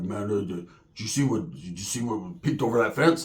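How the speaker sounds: low, soft, spooked hush; hushed scared. Retold or imitated. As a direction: whisper it like you saw a monster.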